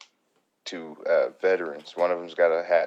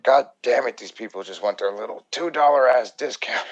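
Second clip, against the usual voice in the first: higher, louder mock outrage at the discount; mock angry customer; 6 semitones higher, 7 dB louder than usual. Waiter imitating the customer's angry gripe, higher and louder. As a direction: ham up the irritated customer griping. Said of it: "And she's like".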